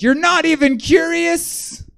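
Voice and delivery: very high, slow, whiny put-on voice; desperate pleading creep. Stalker begging the comic to ask him, whiny desperate voice. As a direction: stretch it out like a needy guy begging for attention. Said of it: "He's like".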